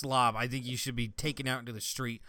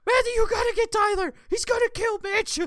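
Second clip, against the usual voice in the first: high, loud frantic yell; panicked shout; 8 dB louder than usual. Retold or imitated. Mom bursting in shouting about the brother, frantic yell. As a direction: scream for help like a panicked mom.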